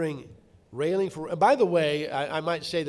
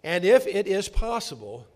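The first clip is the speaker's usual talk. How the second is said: slow, louder solemn reading; scripture reading; 2 semitones higher, 6 dB louder, slower than usual. Acted. Preacher reading Romans twelve aloud to the congregation, solemnly. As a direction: proclaim each verse deliberately.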